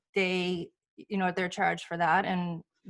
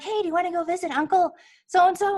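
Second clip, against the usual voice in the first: a touch higher, eager invitation; lightly voiced bright invite; 8 semitones higher, 4 dB louder than usual. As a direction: lightly play herself asking excitedly. Calling sister, acted quote.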